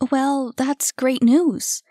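grudging admission. A narrator's reading. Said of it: "she admitted".

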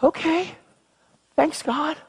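high light okay. Acted. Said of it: "Just be".

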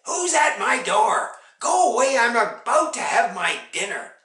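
loud, high, cross dismissal; irritated shout. A narrator's reading. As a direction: send the caller away from your dinner. Witch shouts at unwanted visitor.

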